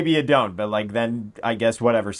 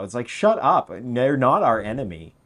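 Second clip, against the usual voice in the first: ordinary delivery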